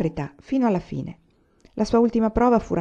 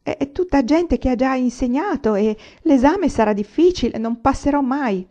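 shaky, higher and anxious, slowing at the end; trembling fear; 6 semitones higher, 4 dB louder than usual. A narrator's reading. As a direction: stammer out the worry. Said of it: "Le tremava la voce".

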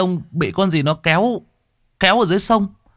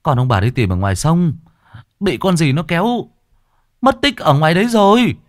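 louder, rising breathless report; out of breath; 8 semitones higher, 5 dB louder than usual. Acted. Messenger panting at the gate, telling the parents. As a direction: gasp out the news after running. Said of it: "thở hồng hộc".

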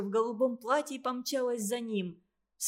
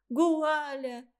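put-on child whispering through a mouthful; child with mouth full; 4 semitones higher than usual. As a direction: say it round a bite of apple. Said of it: "с полным ртом прошептала девочка".

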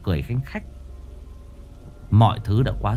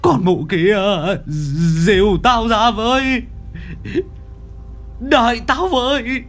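angry cursing; 10 semitones higher than usual. Acted. Abandoned husband yelling after his fleeing wife. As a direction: curse her as she runs off. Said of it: "Miệng chửi".

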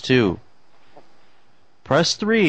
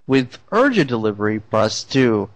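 answering machine voice. Pretend. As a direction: recite menu like a robot system. Fake voicemail menu.

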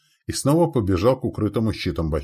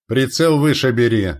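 loud, high aiming command; forceful commander's shout; barked order; 6 semitones higher, 8 dB louder than usual. A narrator's reading. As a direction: bark the correction at the gunners. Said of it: "рявкнул командир бригадных орудийщиков".

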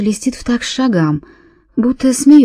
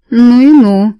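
loud, high Irish exclamation; Irish accent; 4 semitones higher, 7 dB louder, slower than usual. Acted. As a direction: proclaim it in a strong Irish accent. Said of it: "с отчётливым ирландским акцентом провозгласила она". (громко, с ирландским акцентом)

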